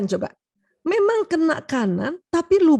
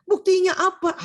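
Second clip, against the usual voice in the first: higher, louder challenge; demanding wife, acted; 6 semitones higher, 5 dB louder than usual. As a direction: play a wife insisting on proof. Acted wife demanding proof of love. (istri menuntut bukti, diperagakan)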